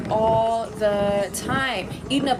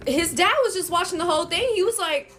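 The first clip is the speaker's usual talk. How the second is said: ordinary delivery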